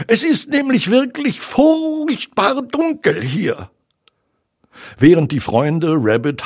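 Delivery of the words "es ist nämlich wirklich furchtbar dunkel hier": very high, timid creature voice; high squeaky piglet; little Piglet. A narrator's reading. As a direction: confide it as a small, timid piglet. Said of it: "freute sich Ferkel".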